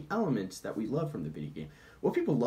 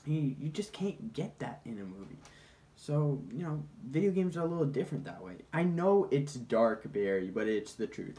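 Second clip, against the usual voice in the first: ordinary talk, nothing marked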